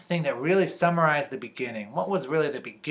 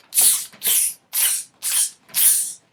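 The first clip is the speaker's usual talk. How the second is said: short voiceless monkey hisses; monkey chatter; much slower than usual. Acted. Monkeys answer the scolding peddler, sharp voiceless tsks. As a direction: tss out a row of quick monkey noises.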